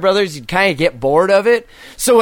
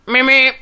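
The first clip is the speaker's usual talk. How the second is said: high, loud meep meep; car horn imitation; 6 semitones higher, 3 dB louder than usual. Shown as a demonstration. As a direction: beep like a honking car. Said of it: "Honk and wave to strangers".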